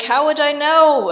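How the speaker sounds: loud, high mock retort; exasperated protest. Wife answering raging Marquis, high exasperated mock protest. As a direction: throw it back in hammed-up exasperation.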